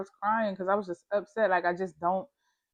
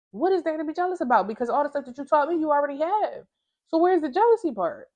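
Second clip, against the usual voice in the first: incredulous questioning; 8 semitones higher, 4 dB louder than usual. Retold or imitated.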